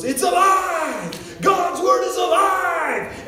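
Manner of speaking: huge, high, slow proclamation; triumphant shout. Retold or imitated. Preacher atop a table with his Bible, shouting triumphantly. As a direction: bellow it out, stretching every word. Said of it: "and he goes".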